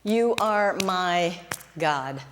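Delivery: slow, soft and intimate: you are my God; a hushed, heartfelt confession of faith; tender, slow prayer. Shown as a demonstration. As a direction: linger on every word like a whispered vow. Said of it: "I think about this".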